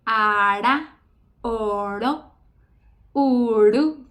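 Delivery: "Ara, oro, uru" with a strong rolled R; trilled R demo. Shown as a demonstration. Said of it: "va a caer con un poquito de fuerza".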